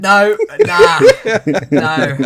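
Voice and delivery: high, loud laughing denial. Real, not acted. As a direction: laugh off the idea.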